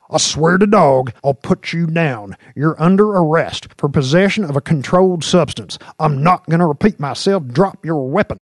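high, forceful shouted arrest; yelling, unnecessarily loud. A narrator's reading. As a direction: bellow the arrest script at a defiant suspect. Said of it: "Jinx was yelling unnecessarily loud".

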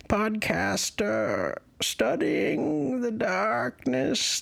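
high, slow, grand character voice; lofty falsetto Coswald; Coswald Doublepot voice. Acted. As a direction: announce himself high and drawn out. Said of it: "I'm Coswald".

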